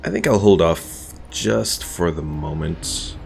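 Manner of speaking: usual speaking voice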